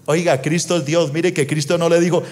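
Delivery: usual speaking voice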